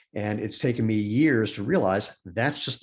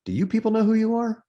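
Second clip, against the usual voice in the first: high-pitched, earnest question; confiding mother; 11 semitones higher, 3 dB louder than usual. Retold or imitated. Mother leaning in to her children, high and confidential. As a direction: lean in and ask it high, as if checking on them. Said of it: "my mom goes like this".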